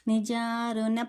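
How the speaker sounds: slow, careful recital of the full name; slow model recitation. Shown as a demonstration. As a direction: recite it slowly so each stretched and stressed syllable is clear. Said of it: "ఇది మొత్తం ఎలా పలకాలి".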